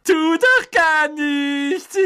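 high, slow, loud gleeful squeal; cackling poltergeist. A narrator's reading. Peeves taunts the angry professor, cackling innocence. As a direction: draw it out in a high mocking cackle. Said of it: "gackerte Peeves".

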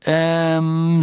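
unsure, hesitant schoolboy; a hesitant teen's um; hesitant boy. Acted. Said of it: "sagte Ron zögernd".

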